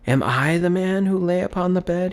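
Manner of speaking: cried out. Acted. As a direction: cry out in dread on his knees. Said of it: "he cried upon his knees".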